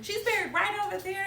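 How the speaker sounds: tossing it off like it's nothing; imitating her mom. Mimicking her mom answering from the car, high and offhand. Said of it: "my mom's like".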